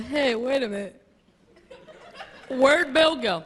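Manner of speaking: lightly voiced puzzled fish; a fish wondering where its friend went. Fish asking the others where Bill went, lightly voiced. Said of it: "some other fish were just swimming around going".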